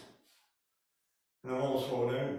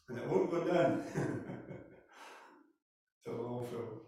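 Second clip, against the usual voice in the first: ordinary talk, nothing marked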